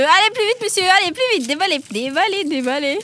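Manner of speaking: high-pitched excited hurrying; rapid-fire hurry-up calls; urging on. Real, not acted. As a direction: chivvy them along with urgent repeats. Host hurries contestant unwrapping sweets.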